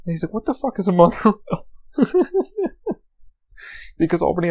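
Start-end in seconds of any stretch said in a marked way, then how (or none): 0.3-1.4 baffled. Retold or imitated. Friend baffled by the monorail. 2.0-2.9 high-pitched burst of giggling; amused laugh. Real, not acted. Storyteller cracking up at friend's monorail question, amused laugh.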